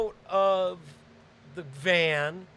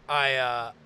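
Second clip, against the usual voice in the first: usual speaking voice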